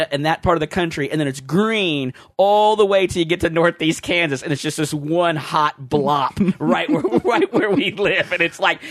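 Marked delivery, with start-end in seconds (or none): none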